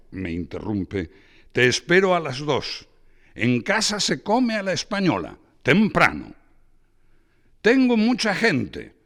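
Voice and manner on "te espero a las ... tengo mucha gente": much higher and louder, bragging about his guests; boisterous Braulio. Acted. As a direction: show off the party like a host. Braulio voiced by reader.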